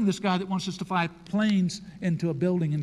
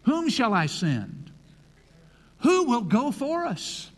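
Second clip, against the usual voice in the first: voice of the Lord; 7 semitones higher, 3 dB louder, slower than usual. Acted. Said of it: "I heard the voice of the Lord".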